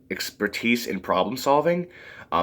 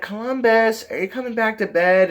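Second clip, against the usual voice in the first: put-on high-pitched girlish calling voice; Madison; 13 semitones higher than usual. Madison interrupts the conversation, high girlish imitation. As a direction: call sweetly in a put-on high voice. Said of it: "Madison comes down at the worst timing".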